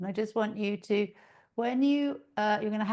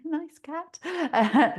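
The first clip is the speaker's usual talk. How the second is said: delighted surprise; 8 semitones higher, 6 dB louder than usual. Real, not acted. Spots cat on her lap.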